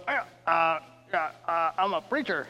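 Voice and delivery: hesitant and muffled, mouth near the ground; face in the dirt. Retold or imitated. Preacher pinned face down answering police, muffled and hesitant. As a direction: talk without sucking up dirt. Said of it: "I'm kind of talking like this 'cause I don't wanna suck up too much dirt".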